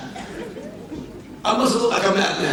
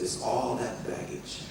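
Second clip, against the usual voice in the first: much lower, softer, slow; quiet revelation; 8 semitones lower, 12 dB quieter, slower than usual. Retold or imitated. Holy Spirit speaking softly to the preacher, low and slow.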